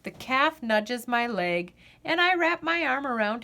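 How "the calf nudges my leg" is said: ordinary delivery, nothing marked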